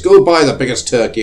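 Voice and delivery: hammed-up Victorian gent; Scrooge ordering. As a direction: bellow it like a generous Scrooge. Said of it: "throws us gold coins and says".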